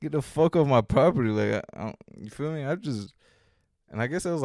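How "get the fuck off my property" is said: loud, raised-pitch eviction; re-enacted heated shout; angry order. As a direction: order them off, loudly. Retold order to police to leave.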